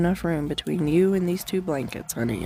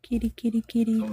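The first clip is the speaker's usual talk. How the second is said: higher kitty call; calling the cat; 7 semitones higher, faster than usual. Real, not acted.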